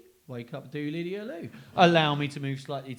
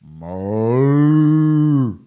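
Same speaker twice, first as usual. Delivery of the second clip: loud lowing moo; cow moo; 16 dB louder than usual. Acted. Cow joining in, loud moo. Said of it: "he fetched a cow".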